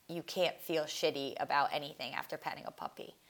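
ordinary talk, nothing marked

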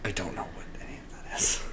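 hushed confused whisper. Real, not acted. Whispered aside.

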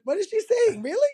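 high, disbelieving question; incredulous. Retold or imitated. Therapist retelling his doubt about the client, incredulous. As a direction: pitch up in mock disbelief at her claim.

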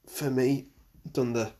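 usual speaking voice

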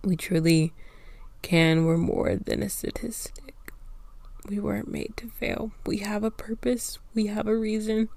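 soft, whispered, emotional; tearful hushed resolve. Real, not acted. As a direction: speak of purpose through tears. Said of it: "I'm literally crying".

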